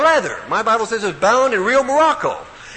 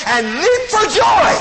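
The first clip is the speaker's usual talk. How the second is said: high, loud burst of joy; exultant shout; 13 semitones higher, 6 dB louder, slower than usual. Acted. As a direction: shout it as if leaping up. Preacher acts out leaping for joy.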